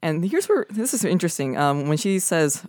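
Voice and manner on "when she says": ordinary talk, nothing marked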